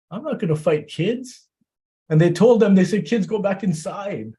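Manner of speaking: high, loud, rising sermon cry; impassioned preaching. Acted. Preacher at full force telling the mourners of the two boys. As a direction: carry the mourners to the climax.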